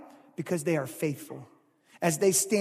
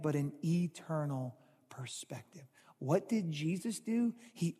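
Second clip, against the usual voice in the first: whisper; 2 semitones lower, 7 dB quieter than usual. Real, not acted. Pastor drops to a whisper.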